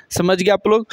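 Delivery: usual speaking voice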